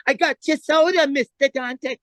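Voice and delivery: put-on very high servile errand-boy voice; meek lackey. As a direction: squeak it out as an eager gofer. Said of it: "And Dice comes back".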